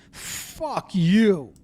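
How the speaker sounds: imitated venomous curse, stretched out; nasty, upset curse. Orioles manager cursing the pitcher, with emphatic nastiness. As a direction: spit out the insult like an upset manager. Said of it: "with a lot of na".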